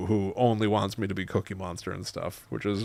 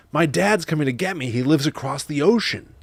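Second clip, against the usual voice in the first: high, loud little cartoon fish voice; Nemo; 6 semitones higher, 5 dB louder than usual. Parent playing a film fish for daughter, high rough impression. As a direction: pipe up as an eager young fish. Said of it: "keeps wanting me to talk like".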